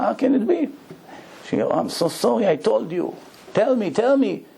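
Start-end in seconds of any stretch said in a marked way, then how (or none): 0.0-0.7 shocked disbelief. Retold or imitated. Shocked friend at the gallery. 1.7-4.4 urgent pleading. Retold or imitated. Daughter begs to hear the news.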